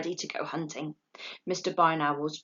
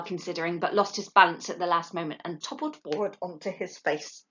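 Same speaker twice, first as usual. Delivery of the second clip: ordinary delivery, nothing marked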